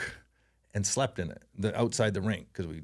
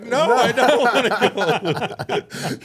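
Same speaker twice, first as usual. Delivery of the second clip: high, loud, drawn-out refusal; comically panicked plea through laughter; mock panic; 12 semitones higher, 9 dB louder, much slower than usual. Small kid challenged to fight by a meathead, mock panic.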